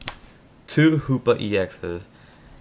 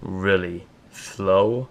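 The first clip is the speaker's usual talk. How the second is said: really slow, drawn-out words; deliberately slow; much slower than usual. Real, not acted. Lisping player promises viewers he'll talk slower, deliberately slow. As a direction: stretch each word out slowly and carefully. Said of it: "I'll try to talk".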